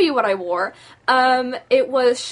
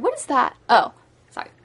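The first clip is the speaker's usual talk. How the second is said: usual speaking voice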